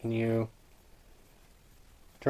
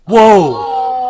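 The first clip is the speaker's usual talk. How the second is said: sudden loud whoa; startled shout; 9 semitones higher, 12 dB louder than usual. Real, not acted. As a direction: jump at a noise. Startled by something.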